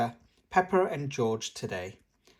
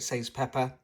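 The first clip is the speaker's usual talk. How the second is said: ordinary delivery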